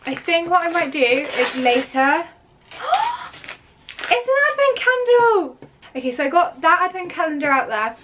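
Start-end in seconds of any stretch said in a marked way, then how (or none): 2.7-5.6 high gasp, then delighted exclaim. Real, not acted. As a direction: gasp at the surprise gift. Unwrapping gift.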